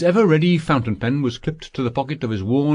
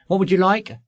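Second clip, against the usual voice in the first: put-on boy waiter; 8 semitones higher than usual. A narrator's reading. Waiter boy asks the order.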